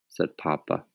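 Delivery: ordinary delivery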